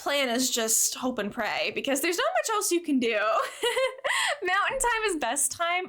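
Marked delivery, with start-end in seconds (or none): 3.2-4.4 high giggle. Real, not acted. Laughs at her own joke.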